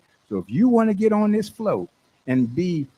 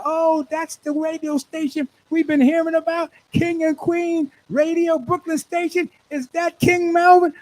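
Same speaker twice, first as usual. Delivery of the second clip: much higher-pitched, excited onlooker voice; excited spectators; 11 semitones higher than usual. Acted. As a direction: act out the crowd spotting a star. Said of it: "They're gonna be talking about".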